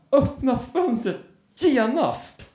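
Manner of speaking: put-on high, slow, drawn-out child's command; demanding little kid. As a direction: imitate a small child giving imperious orders. Voices his child demanding window opened. (befallande barnröst, högt och utdraget)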